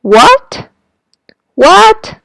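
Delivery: What rising steeply at the end; second tone rise. Shown as a demonstration. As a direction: pitch What up like a surprised question. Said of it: "similar to Chinese second tone".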